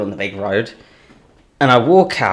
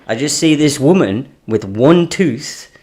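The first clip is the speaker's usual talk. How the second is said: usual speaking voice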